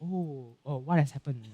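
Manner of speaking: soft, low feigned innocence; cheeky innocent. Acted. Preacher acts Jesus feigning ignorance to disciples, cheeky innocent. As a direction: play dumb, quietly and coyly. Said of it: "like, a very cheeky, innocent face".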